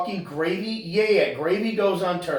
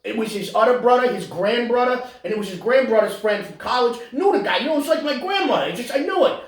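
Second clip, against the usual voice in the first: high, excitable mimicry; hammed-up rambling name-dropper; mock Italian-American braggart; 7 semitones higher than usual. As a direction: boast insistently in a put-on Italian-American voice.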